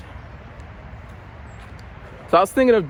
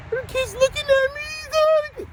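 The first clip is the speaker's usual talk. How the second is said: put-on much higher kid voice; mock kid complaint. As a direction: whine it like a kid.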